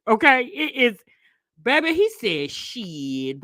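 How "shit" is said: low, drawn-out casual mumble of an employee; casual young cashier. Retold or imitated. Young cashier listing sides to a customer, low casual drawl. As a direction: imitate a nonchalant young worker, pitched down.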